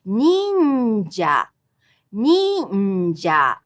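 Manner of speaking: "ninja" high on nin, falling, slow; high-low pitch. Shown as a demonstration. Teacher demonstrating the word, slow high-low fall. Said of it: "going from high to low".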